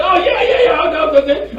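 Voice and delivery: high, mock-eager agreement; playing along eagerly. Man playing along with a scam caller, mock-eager. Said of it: "sometimes I play along with them".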